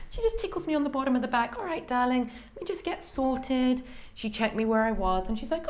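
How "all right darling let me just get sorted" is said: higher, unhurried cheerful reassurance; easygoing, slowed-down darling; light higher-pitched calm doctor; breezy midwife. Retold or imitated. Annie arrives, settles in.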